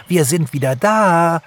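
high, loud bird cheer; jubilant. A narrator's reading. Birds announce they are back from winter holiday, cheering. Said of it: "jubelten sie".

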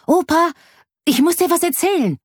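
put-on very high-pitched excited boy; bursting to tell, high child voice; eager child. A narrator's reading. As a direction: call grandpa with urgent news. Child eagerly calls his grandpa.

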